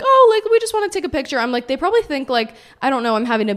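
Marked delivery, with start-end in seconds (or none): 0.0-1.4 much higher, louder, quick gushing fan voice; mocking eager fans. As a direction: squeal it like starstruck guys. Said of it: "These guys are like".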